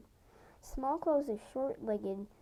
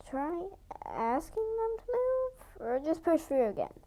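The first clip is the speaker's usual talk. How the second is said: suggesting; 7 semitones higher, 3 dB louder than usual. A narrator's reading. A hint of a littermate suggesting a way. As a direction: offer the idea gently. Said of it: "she suggested".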